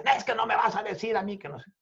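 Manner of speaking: fast, high mock protest; retold yelling. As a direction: rattle off an imitation of his yelling. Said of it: "él estaba gritando". (grito imitado, rápido y agudo)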